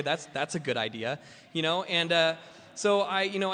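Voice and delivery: ordinary talk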